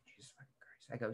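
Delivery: hushed, near-whispered aside; exasperated under breath. Retold or imitated. Waiting customer grumbles about antsy travellers, exasperated under the breath. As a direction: mutter it to yourself, barely voiced. Muttered reaction to impatient customers.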